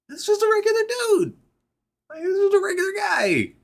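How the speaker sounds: high-pitched excited squeal; high, loud wonder, retold; amazed fan reaction. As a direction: marvel like a thrilled kid, voice way up. Reenacts his excited childhood reaction.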